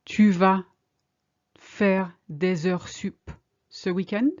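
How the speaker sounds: slowly, in two parts with a pause; slow, chunked. Shown as a demonstration. Teacher modelling a question for learners, slowly chunked. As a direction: break it up for learners. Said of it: "Are you going to work overtime this weekend?".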